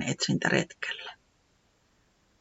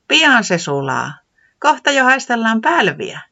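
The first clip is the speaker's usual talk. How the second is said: high, happy raised voice; Säämies rejoicing; 8 semitones higher, 5 dB louder than usual. Acted. Säämies rejoicing at spring, high and glad. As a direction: cheer about spring. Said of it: "säämies iloitsi".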